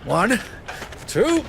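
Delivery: effortful count while pulling hard; counting through the strain of pulling. Acted. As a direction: grunt out the count as you strain. Straining to break pipe.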